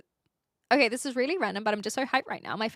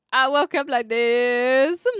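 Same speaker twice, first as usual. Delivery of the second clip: slow, smug and drawn out; posed diva line; 7 dB louder, slower than usual. Pretend. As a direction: say it like a vain diva. Reading a compliment, slow posed reply.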